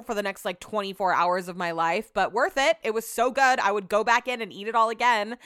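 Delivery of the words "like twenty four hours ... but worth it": usual speaking voice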